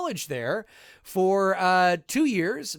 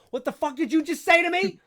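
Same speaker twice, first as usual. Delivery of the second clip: put-on angry driver voice; mock furious driver yelling out the window; mock road rage; 11 semitones higher than usual. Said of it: "He rolls down his window".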